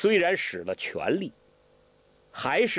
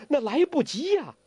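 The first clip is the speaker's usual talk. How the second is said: high-pitched, urgent protest; anxious; 11 semitones higher than usual. Acted. Huang Rong answering Guo Jing on the sinking boat, anxious. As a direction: burst out high in alarm. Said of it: "黄蓉急了".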